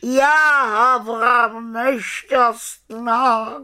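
high, loud, drawn-out wail; moaning lament. A narrator's reading. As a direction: wail in misery as the unhappy raven. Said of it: "sténal dobrý Abraxas". (hlasité, táhlé sténání)

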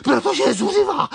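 high, loud, panicked beetle voice; frantic cry. Acted. Hurried beetle telling why they must flee, frantic cry. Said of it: "vykřikl kvapník".

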